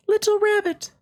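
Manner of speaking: very high, gentle fairy voice. Acted. Fairy greeting the little rabbit, high and gentle. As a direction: sing it out light and high like a fairy. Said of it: "she said".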